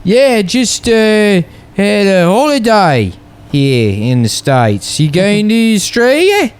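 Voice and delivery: high, light imitation of an Australian guy; lightly voiced Aussie tourist asking; a hint of Australian accent. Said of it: "He's like".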